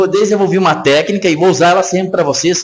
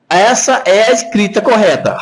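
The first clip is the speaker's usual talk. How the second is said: normal talking voice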